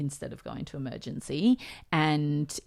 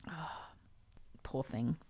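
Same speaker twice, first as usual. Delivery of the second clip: tearful whisper; 3 semitones lower, 10 dB quieter than usual. Real, not acted. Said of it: "I'm not getting emotional right now".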